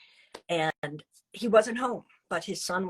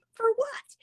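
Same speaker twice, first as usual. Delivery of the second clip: very high squeaked for what; incredulous. Retold or imitated. Woman threatened with police, squeaking disbelief. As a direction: protest in disbelief.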